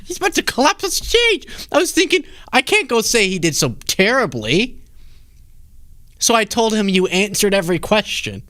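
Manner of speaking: playful high imitation of a wife on TV; mock wife voice. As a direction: lampoon the wife's TV confession in a high voice. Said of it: "you just hear her being like".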